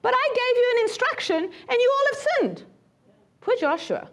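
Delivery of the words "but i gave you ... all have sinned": 1 God scolding. Retold or imitated. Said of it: "God's going like".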